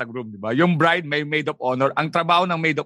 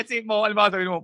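ordinary delivery

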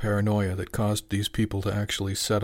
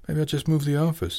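teasing; 7 semitones higher than usual. Acted. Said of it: "Jimmy teased".

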